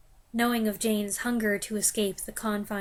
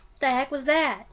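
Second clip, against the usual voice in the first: ordinary talk, nothing marked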